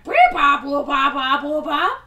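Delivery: loud beep boop robot noises. Retold or imitated. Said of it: "It goes basically exactly like that".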